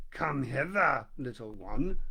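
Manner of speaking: low, slow crocodile lure; sly crocodile. A narrator's reading. Crocodile luring the elephant's child, low and slow. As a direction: coax the little one closer, slowly.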